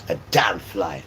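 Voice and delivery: ordinary talk, nothing marked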